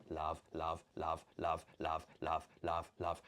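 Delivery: low, soft monotone chant; mechanical repetition. Acted. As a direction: dispense each word identically, low and soft. Dispensing love from thumb mechanically.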